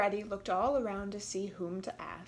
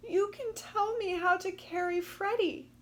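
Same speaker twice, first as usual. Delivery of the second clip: Katy pleading; 10 semitones higher than usual. Acted.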